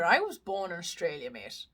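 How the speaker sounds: low, soft slip into an Irish accent. Real, not acted. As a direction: say it with an unintended Irish lilt. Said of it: "I said that in an Irish accent".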